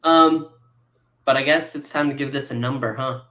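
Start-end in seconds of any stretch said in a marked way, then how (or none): none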